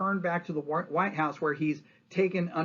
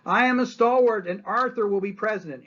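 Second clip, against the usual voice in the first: higher, louder declaration; retold shout; 6 semitones higher, 5 dB louder than usual. Assassin shouting his cause on the railway platform, loud. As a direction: proclaim it as you pull the trigger. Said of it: "he shouts".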